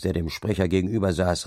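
usual speaking voice

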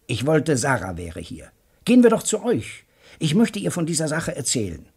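eager to rush off; bursting with excitement; excited outburst. Acted. Joe Welling wants to see Sarah.

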